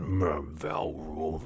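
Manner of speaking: grudging growly grumble; reluctant orc muttering under protest; grumbling orc. Acted. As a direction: grumble and groan before giving in. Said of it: "grumbles a little".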